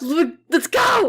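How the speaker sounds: high, drawn-out war cry; explosive high-pitched rallying shout; hyped-up shout. Real, not acted. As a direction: yell it to pump everyone up. Pep talk peaks in shout.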